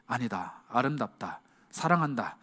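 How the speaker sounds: quiet loving reply; tender reassurance. Acted. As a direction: reassure them softly, almost a whisper. God's tender answer voiced.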